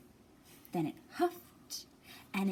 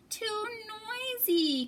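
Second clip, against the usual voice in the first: very high, slow whining bear; high drawn-out cub complaint; crying baby bear; 13 semitones higher, much slower than usual. Acted. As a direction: wail it like an upset cub. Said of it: "cried Baby Bear".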